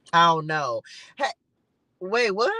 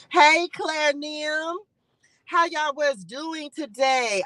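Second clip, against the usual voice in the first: drawn-out greeting; 4 semitones higher, slower than usual. Real, not acted. Greeting a viewer by name.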